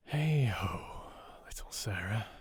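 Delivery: soft unvoiced whisper to a sleeping child; tender fatherly whisper. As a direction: breathe it so she won't wake. Father murmurs over sleeping child.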